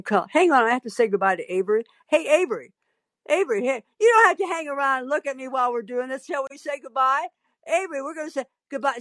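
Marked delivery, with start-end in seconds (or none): 2.1-9.0 higher-pitched, quick call to someone nearby; calling out aside. Real, not acted. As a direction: break off and chirp over to a coworker. Calls aside to Avery on air.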